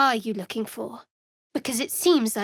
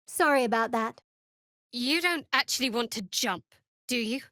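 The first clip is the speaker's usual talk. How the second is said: ordinary talk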